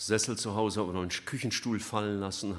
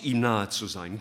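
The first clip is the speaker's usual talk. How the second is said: usual speaking voice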